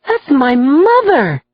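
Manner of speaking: slow, high, wondering; awed at seeing his mother. A narrator's reading. Son spotting his mother in court, in wonder. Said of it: "with wonder in his voice".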